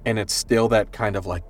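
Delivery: usual speaking voice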